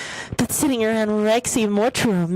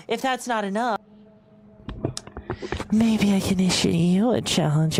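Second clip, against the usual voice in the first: ordinary delivery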